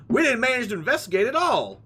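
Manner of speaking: put-on high, lightly Southern assistant girl; Southern accent. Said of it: "She's gonna be Southern now".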